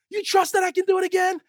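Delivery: high, loud mock showman shout; rally the crowd with a challenge; showman's call. Tightrope walker challenges the crowd, shouting. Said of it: "He's like".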